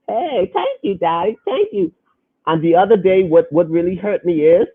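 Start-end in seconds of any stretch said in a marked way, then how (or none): 0.1-1.9 high, childlike thank-you with a hint of tears; little daughter's voice. Father imitating his little girl thanking him, high and teary. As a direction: imitate a small girl thanking her daddy sweetly.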